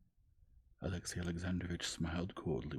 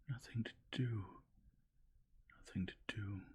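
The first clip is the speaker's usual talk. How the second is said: tearful echo; 5 semitones higher than usual. A narrator's reading. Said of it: "she said with tears in her eyes".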